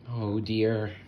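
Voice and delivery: ordinary delivery, nothing marked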